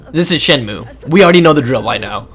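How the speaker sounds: ordinary delivery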